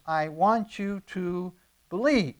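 "believe" held back, then drawn out with a fall; stress on BELIEVE. Retold or imitated. Preacher relaying Jesus's plea to Nicodemus, stress on "believe". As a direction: slowly build to the word "believe". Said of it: "his stress in, to Nicodemus is".

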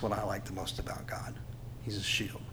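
a reverent whisper to the crowd; whispered aside about God; hushed whisper. Real, not acted. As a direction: breathe it out as a whisper. Preacher drops voice on key line.

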